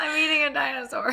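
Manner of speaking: shouting through tearful laughter; laugh-crying shout. Real, not acted. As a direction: wail it while laughing and teary. Laughing and tearing up.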